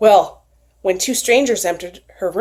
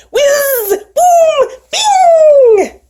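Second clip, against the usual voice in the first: magic whoosh sound; 6 dB louder, much slower than usual. A narrator's reading. Magic flight sound effect.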